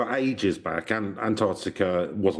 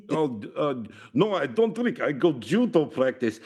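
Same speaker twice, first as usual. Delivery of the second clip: higher Russian voice, hesitant refusal; comic Russian accent; 7 semitones higher than usual. Acted. Russian newcomer turns down the pub, comic Russian accent. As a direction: decline in a hammed-up Russian accent. Putin answers the locals.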